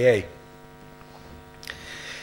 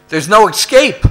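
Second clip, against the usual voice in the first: loud, much higher despairing cry; desperate outburst; 9 semitones higher, 10 dB louder than usual. Retold or imitated. Addict telling Jimmy in the hole, loud despair. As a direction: burst out in hopeless panic.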